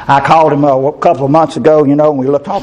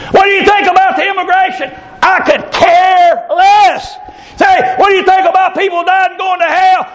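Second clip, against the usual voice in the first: preaching at full force. Acted. Preacher shouting to the congregation at full force. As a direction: shout it out from the pulpit.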